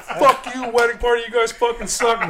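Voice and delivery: high, loud mimicked heckling; talking shit. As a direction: imitate bar patrons jeering from above. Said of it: "talking shit to everybody".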